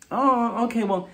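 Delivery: high-pitched mock polite brush-off; Heather. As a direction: lift the voice into put-on politeness. Voicing Heather awkwardly rejecting Lisa's audition.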